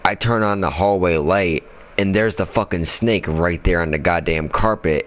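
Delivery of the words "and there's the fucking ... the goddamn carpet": usual speaking voice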